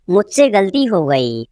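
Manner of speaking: ordinary talk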